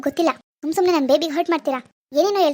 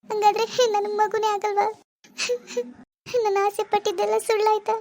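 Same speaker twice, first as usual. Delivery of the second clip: higher, softer tearful plea; crying; 5 semitones higher, 3 dB quieter than usual. Acted. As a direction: ask through tears, heartbroken. Daughter weeps over infertility. (ಅಳುತ್ತಾ ಕೇಳುವುದು)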